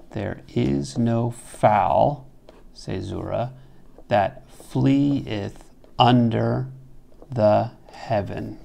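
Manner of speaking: slow, with a pause at the caesura; slow metrical reading. Shown as a demonstration. Teacher reads the fixed line slowly to show the meter. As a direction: read it out beat by beat, slowly. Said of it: "Perfect decasyllabic line".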